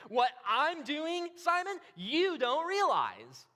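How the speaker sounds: "I'm" and "you" stretched, high and loud; stress on pronouns. Acted. Jesus answering Simon, heavily stressed pronouns. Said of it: "he also emphasizes his pronouns here".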